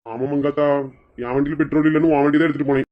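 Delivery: fast, low, soft confession; rushed guilty confession. Acted. Husband quickly confesses to his wife he took her scooter, fast and low. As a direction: blurt it out quickly before she reacts.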